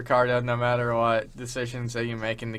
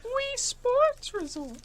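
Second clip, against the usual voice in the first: mock high voice; much slower than usual. Echoing game title in funny voice.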